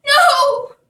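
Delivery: loud high wail of dismay; cry out. Acted. Jack crying out at the guard, loud high dismay. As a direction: shout it in horror. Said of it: "cried Jack".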